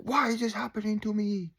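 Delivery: mock his old self's complaint; whiny self-pity.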